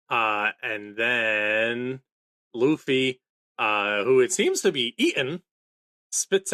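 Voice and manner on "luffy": normal talking voice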